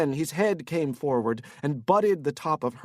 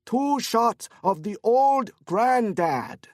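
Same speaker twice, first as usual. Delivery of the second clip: put-on much higher and louder, slow Korean-accented order; shouted order; 8 semitones higher, 5 dB louder, slower than usual. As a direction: call it across the bar. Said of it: "shouted in the direction of the bartender".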